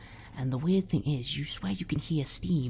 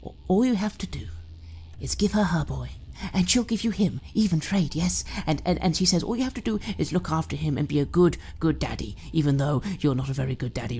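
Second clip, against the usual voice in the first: creepy doting mother; 3 semitones higher, faster than usual. Acted. Mother wheedling her grown son Joshy, creepy doting voice. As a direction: coax your son sweetly, menace underneath.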